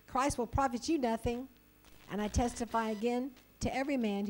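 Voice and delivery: measured, emphatic Bible reading; scripture reading. Acted. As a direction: read the passage with authority. Reads Galatians aloud.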